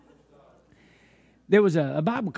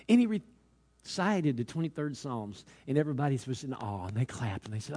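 normal talking voice

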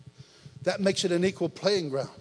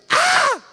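very high, loud shriek of joy; mama's joyful scream; 12 dB louder than usual. Retold or imitated. Preacher acting his mama's answered-prayer scream. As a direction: scream like a mother whose prayers came true. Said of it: "She screamed".

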